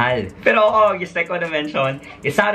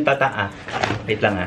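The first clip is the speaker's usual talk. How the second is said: normal talking voice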